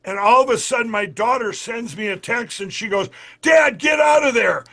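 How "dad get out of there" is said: daughter's warning voiced higher and louder; urgent warning. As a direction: call it out like she's yelling at dad.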